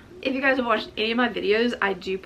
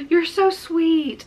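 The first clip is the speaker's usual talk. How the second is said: touched and delighted; 8 semitones higher, 6 dB louder, much slower than usual. Real, not acted. Touched by the gifts.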